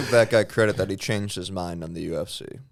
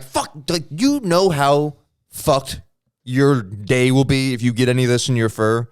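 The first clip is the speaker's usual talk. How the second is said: higher, louder mock scolding of a pet; exasperated warning; 4 semitones higher, 6 dB louder than usual. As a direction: warn the cat in mild exasperation. Warning the cat in acted quote.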